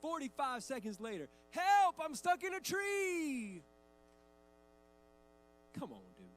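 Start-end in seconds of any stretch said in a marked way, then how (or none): 1.5-3.6 put-on high, loud, drawn-out kid yell; child's cry for help. Son starts game again. 5.8-6.3 low, quiet mock-weary groan of words; weary exasperation. Weary dad after repeated game.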